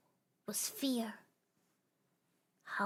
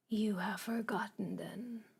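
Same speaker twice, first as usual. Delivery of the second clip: lightly voiced darkness. The darkness leaving the star with a hushed warning. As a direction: let it die away into the dark.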